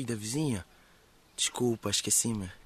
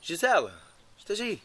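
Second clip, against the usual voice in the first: calling out; 8 semitones higher than usual. Acted. Calling for a friend nearby.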